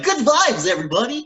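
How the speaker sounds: high, loud, excited greeting; exaggerated peppy mimicry of oneself; over-bright self-parody. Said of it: "Meanwhile, I'll come on".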